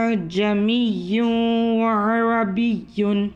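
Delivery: slow Quranic recitation, second hamza softened; tashil recitation. Shown as a demonstration. Teacher recites with tashil for students. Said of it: "एक मरतबा दोबारा पढ़ लेती हूँ".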